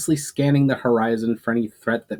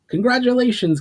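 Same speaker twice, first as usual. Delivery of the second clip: higher, louder call across the field; 13 semitones higher, 4 dB louder than usual. A narrator's reading. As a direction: shout it over to a hunter. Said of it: "called Amjo".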